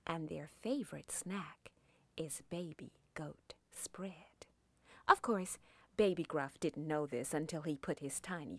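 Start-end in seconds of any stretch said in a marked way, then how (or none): 0.1-4.5 slow, hushed storyteller whisper; spooky whisper. A narrator's reading. Storyteller whispering to the children about the troll's snack. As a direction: lean in and whisper the scary secret. Narrator's spooky secret to listeners.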